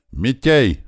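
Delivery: high, loud summons; yelled name. A narrator's reading. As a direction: call out to someone across a crowd. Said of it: "заорал Туман". (громкий окрик по имени)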